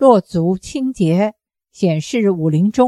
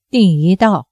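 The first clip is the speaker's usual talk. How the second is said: plain reading voice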